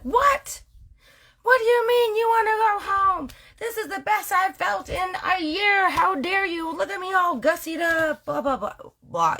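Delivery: huffy high disbelief at him leaving; wife offended that husband wants to go home, high and outraged; indignant wife. Retold or imitated.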